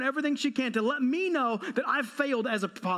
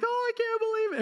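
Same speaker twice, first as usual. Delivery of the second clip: high, appalled mother; mother in the audience gasps in high disbelief; shocked mom; 11 semitones higher than usual. Retold or imitated. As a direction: squeal it like a scandalized mom. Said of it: "Every mom in the room is like".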